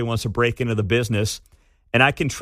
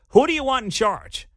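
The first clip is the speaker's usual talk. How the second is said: normal talking voice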